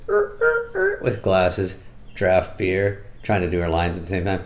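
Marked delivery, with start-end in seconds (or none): 0.1-1.0 very high effortful grunts; mock grunting. Comic mimicking bartender faking bar work. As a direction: grunt like wiping a bar hard. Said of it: "She's like this".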